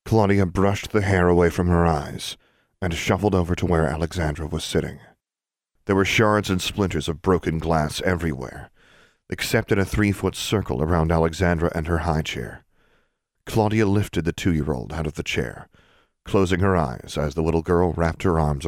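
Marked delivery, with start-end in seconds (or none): none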